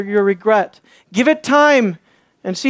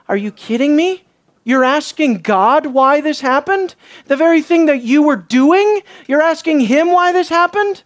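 raised, disbelieving rebuke; acted astonished challenge; incredulous; 4 semitones higher than usual. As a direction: question them as if you can't believe it. Imagined reader's incredulous answer to Israel.

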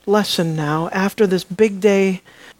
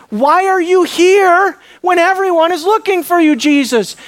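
higher, louder frustrated outburst at Jesus; Peter yelling; 10 semitones higher, 5 dB louder than usual. Acted. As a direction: Peter scolding his master in exasperation. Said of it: "Peter's yelling at him".

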